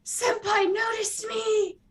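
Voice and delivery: high, slow anime-fangirl gush; smitten squeal. Acted. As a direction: squeal it like a swooning admirer. Smitten character finally gets noticed.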